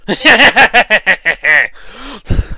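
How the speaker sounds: high cackling evil laugh; generic evil laugh. Shown as a demonstration. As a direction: cackle like a cartoon villain. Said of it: "I'm just doing a generic evil laugh".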